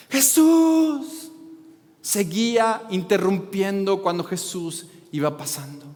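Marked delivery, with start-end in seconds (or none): 0.1-1.1 high, loud cry of the name; desperate shout. Retold or imitated. Blind beggar crying out to passing Jesus, desperate shout. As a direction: call out to Jesus over the crowd. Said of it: "siguió levantando su voz".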